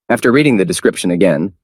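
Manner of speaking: usual speaking voice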